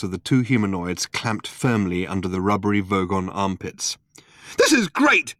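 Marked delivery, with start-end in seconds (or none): 4.6-5.3 high, loud sarcastic splutter. A narrator's reading. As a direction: splutter it in outrage. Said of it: "spluttered Arthur".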